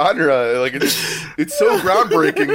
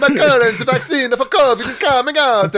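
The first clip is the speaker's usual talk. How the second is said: higher-pitched, hammed-up Italian accent; 7 semitones higher than usual. Shown as a demonstration. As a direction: put on a sing-song mock Italian accent. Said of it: "if it was Italian, I'd be like".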